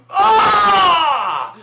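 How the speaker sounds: very high, very loud roar of rage; hammed-up monster scream in close-up; villain battle scream. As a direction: scream it full out like an angry warlord. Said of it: "he's like".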